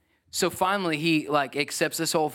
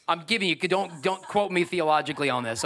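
ordinary talk

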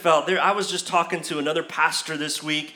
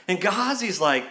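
ordinary talk, nothing marked

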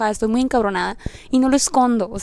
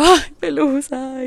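drawn-out, higher mock wail; lamento triste imitado; 4 semitones higher, much slower than usual. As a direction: stretch it out like a grieving moan. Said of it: "me puse como que muy sad". (lamento triste y alargado, recreado)

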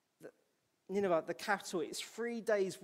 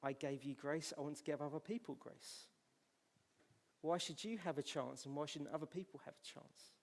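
gentle reply; 8 dB quieter than usual. Acted. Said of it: "And God's saying".

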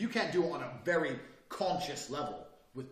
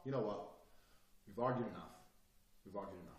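sudden soft, low calm; acted calm, fading to very quiet; sensible, calm; 7 semitones lower, 10 dB quieter, faster than usual. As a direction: drop the voice and quietly end the argument. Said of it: "you being sensible and calm and nice".

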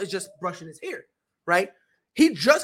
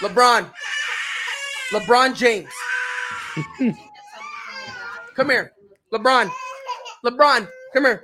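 calling out; 4 dB louder than usual. Real, not acted. Father calling his dramatic son over, loud.

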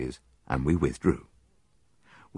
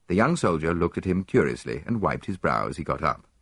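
plain reading voice

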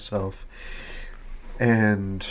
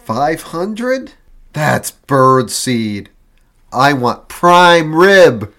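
loud, slow, demanding; hammy demanding shout; 4 semitones higher, 8 dB louder, slower than usual. Acted. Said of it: "a dramatic reenactment".

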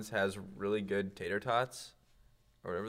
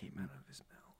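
whispering; 6 semitones lower, 17 dB quieter than usual. Pretend. Whispered joke continues.

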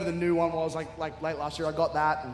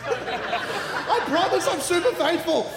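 high, slow, loud laughing promise; laughing hard; 9 semitones higher, 5 dB louder, slower than usual. Real, not acted. Assuring the congregation he is faithful, laughing.

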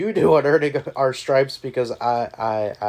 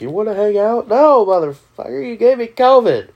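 angry yelling; 9 semitones higher, 11 dB louder than usual. Retold or imitated. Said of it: "He's like".

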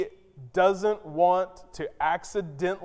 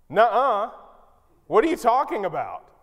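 skeptical brush-off; 5 semitones higher, 4 dB louder than usual. Acted. Zechariah doubts the angel.

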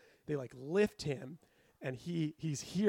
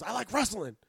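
put-on higher, louder kid-at-heart answer; eager childlike patient; 5 semitones higher, 5 dB louder than usual. Patient answering the EMT trainee, eager childlike voice.

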